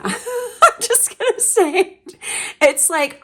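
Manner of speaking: high and slow, laughing through the words; breaking into laughter mid-sentence. Real, not acted. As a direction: giggle it out, a bit embarrassed at yourself. Laughs at her own swearing.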